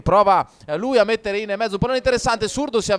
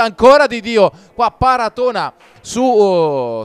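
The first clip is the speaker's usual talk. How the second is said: louder, rising shout at the save; excited save call; 3 semitones higher, 7 dB louder, slower than usual. Real, not acted. Big save called live.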